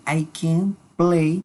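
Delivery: slow, careful English example; slow model sentence. Shown as a demonstration. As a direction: spell it out slowly for learners. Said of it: "simplemente podemos decir".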